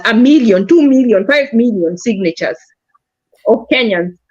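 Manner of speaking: ordinary delivery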